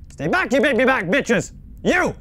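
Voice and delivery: loud playful yell at attackers. Real, not acted. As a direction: shout them back. Fending off animatronics.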